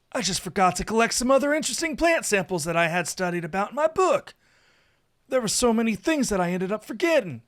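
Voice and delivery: high, loud lament; flustered Saphir. A narrator's reading. Saphir realising he forgot his plant samples, flustered. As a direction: fret over everything you forgot.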